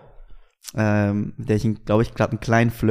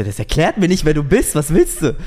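higher and louder, exasperated questioning; irritated retort; 6 semitones higher, 6 dB louder than usual. Retold or imitated. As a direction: snap back at the stranger. Annoyed reply to anonymous caller.

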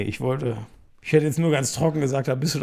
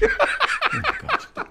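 laughing groan. Real, not acted. Laughs at long season.

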